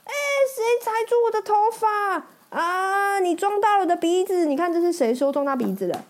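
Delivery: loud complaint. Acted. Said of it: "有人大声地说".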